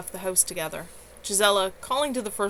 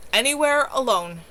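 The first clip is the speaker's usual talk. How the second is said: said tightly; 5 semitones higher, 6 dB louder than usual. A narrator's reading. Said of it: "Deanna said tightly".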